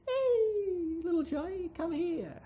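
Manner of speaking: high, coaxing priest voice; a priest calling a boy over; sweet-talking priest. Acted. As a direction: lure the child in sweetly. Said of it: "the priest says".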